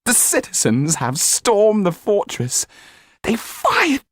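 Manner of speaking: high, loud breathless news; excited valet. A narrator's reading.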